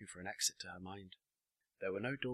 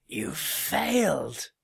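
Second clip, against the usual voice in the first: drawn-out, high, very loud accusation; furious shout; 9 semitones higher, 12 dB louder, much slower than usual. A narrator's reading. Mister Runnings condemning the girl, furious shout. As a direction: bellow it slowly at full force. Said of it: "shouted Mister Runnings".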